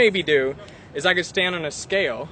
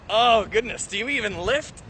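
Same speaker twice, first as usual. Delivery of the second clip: ordinary talk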